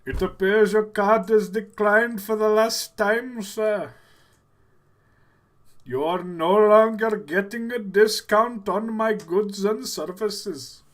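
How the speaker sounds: loud, high put-on Indian accent; slow it down in an exaggerated Indian accent. Call agent tells caller the card is declined, Indian accent. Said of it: "how good of an Indian accent".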